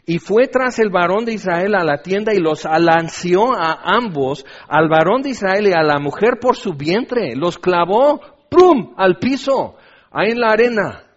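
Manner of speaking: loud, dramatic preacher reading; forceful scripture reading. Real, not acted. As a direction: read scripture with rising force.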